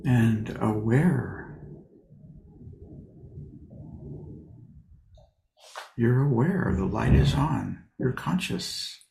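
unhurried, leave long pauses between short phrases; guided meditation. Real, not acted. Teacher settles sitters into posture and breath, guided meditation.

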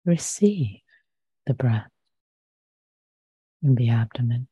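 unhurried, measured meditation voice with long pauses; guided meditation. Real, not acted. Meditation teacher inviting listeners to settle their posture, calm and unhurried. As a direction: guide gently into stillness, leaving long silences.

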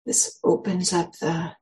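unhurried guidance with long pauses; guided meditation. Acted. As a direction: ease listeners into stillness, phrase by phrase.

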